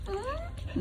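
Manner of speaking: normal talking voice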